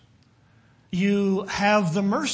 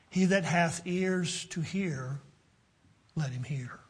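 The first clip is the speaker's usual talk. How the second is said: hushed solemn quote; 3 semitones lower, 9 dB quieter than usual. Retold or imitated. Jesus warning those who may not listen, hushed and solemn. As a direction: let it drop away into a low hush.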